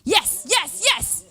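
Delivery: full-voiced victory cheer; triumphant shout. Shown as a demonstration. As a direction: shout it like you just won big.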